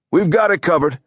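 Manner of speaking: high, projected brush-off; calling out. A narrator's reading. Hunter brushing Allie off while charging paddles, calling out. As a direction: call it out across the room, pitched well up. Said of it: "he called out".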